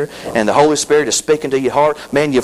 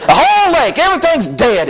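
mock amazement; 13 semitones higher than usual. Real, not acted. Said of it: "joking exaggeration".

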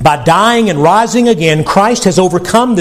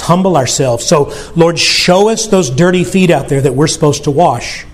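lower, hushed prayer voice; closing prayer; 4 semitones lower than usual. Acted. Pastor leading congregation in prayer, low and humble. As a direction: pray humbly and earnestly to the Lord.

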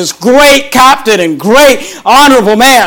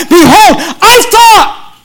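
mad and angry; 8 semitones higher, slower than usual. Acted. Preacher voicing angry Naaman to the congregation, indignant and high. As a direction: act out an angry man's protest, pitched high and slow. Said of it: "he's mad and angry, and he says".

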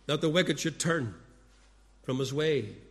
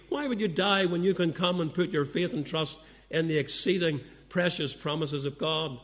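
ordinary delivery

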